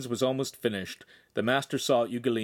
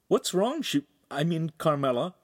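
put-on higher, concerned young voice; worried boy voice; 7 semitones higher than usual. A narrator's reading. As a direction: ask gently, worried. Handsome worried.

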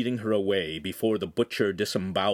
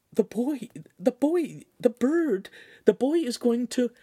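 much higher, panicked stammer; frantic girl; 3 dB louder than usual. Acted. Shamira warning the signora about the boy, frantic and much higher. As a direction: blurt it out in alarm. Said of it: "tugging on Signora Cagliari's sleeve frantically".

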